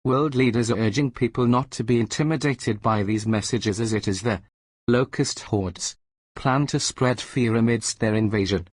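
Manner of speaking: ordinary talk, nothing marked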